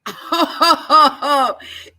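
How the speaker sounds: loud disbelieving laugh. Real, not acted. Laughs at reverse mortgage question.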